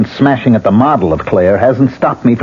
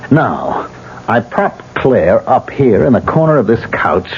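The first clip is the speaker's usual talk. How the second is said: ordinary talk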